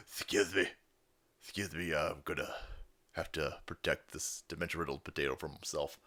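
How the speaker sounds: low, soft deadpan agent voice; understated mock agent; lightly voiced agent. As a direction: mutter it like a bored bodyguard. Acting agent protecting the president.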